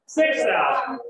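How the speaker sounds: fast bidding call; auctioneer. Pretend. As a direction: rattle off bids like an auctioneer. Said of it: "We need the auctioneer's voice here".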